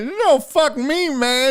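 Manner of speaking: self-pitying heckler. Retold or imitated. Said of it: "He's like".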